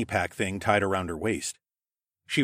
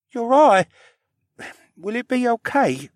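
put-on high-pitched, halting child voice; worried boy; 6 dB louder than usual. A narrator's reading. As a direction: ask with anxious concern, pitched high.

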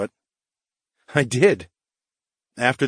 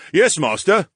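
louder, higher soldier's reply; booming; 8 semitones higher, 6 dB louder than usual. Acted. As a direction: boom it out like a soldier at attention. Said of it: "he boomed".

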